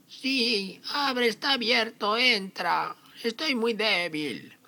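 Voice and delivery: very high, frail granny voice; wolf pretending to be weak grandmother; fake grandma voice. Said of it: "El lobo fingió la voz de la abuela".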